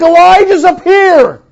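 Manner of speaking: loud, high awed voice; starstruck awe. Retold or imitated. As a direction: speak as a starstruck fan. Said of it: "We think".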